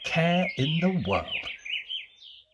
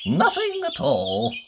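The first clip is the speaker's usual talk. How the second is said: much higher, slow, sing-song call; wizard calling back; slower than usual. Acted. The departing wizard reassures Mister Worry in a high, slow call. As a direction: stretch it out high as you go. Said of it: "he called over his shoulder".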